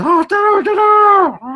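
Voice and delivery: high, loud half-hearted crow; lazy rooster crow. Retold or imitated. Rooster that won't lay eggs, a lazy high crow. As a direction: crow like a rooster who can't be bothered. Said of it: "this cockerel, uh, goes".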